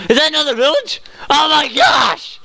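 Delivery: high-pitched shout, stretched out; thrilled discovery. Real, not acted. Player spotting another village, thrilled shouting. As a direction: squeal it, then whoop at the top.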